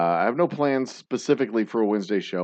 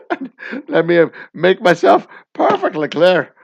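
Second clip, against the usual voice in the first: very loud, hammed-up Irish warning; bad Irish accent; 4 semitones higher, 11 dB louder than usual. Father makes himself perfectly clear, in a bad Irish accent. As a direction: lay down the law in a cartoonish Irish brogue. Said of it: "affect a bad Irish accent".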